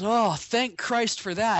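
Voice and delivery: high, loud and slow, gushing relief; rescued man loudly thanking heaven on landing; relieved exclaim. Acted. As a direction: blurt out huge relief.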